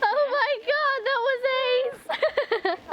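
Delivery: high, slow thrilled shriek; shrieking jackpot winner. Real, not acted. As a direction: squeal it out like a jackpot win. Fireworks just went off.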